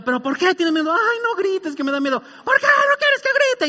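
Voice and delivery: very high, escalating sibling shrieks; mock screaming quarrel. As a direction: act out a frightened child and screaming sister. Acts sister scaring him by screaming.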